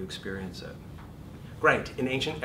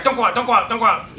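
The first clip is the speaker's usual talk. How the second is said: high, loud frantic pleading; fear voice pleading; 12 semitones higher, 12 dB louder, faster than usual. Acted. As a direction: beg them not to leave. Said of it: "It's the voice like".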